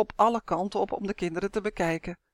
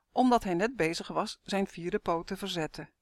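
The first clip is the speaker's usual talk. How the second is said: ordinary delivery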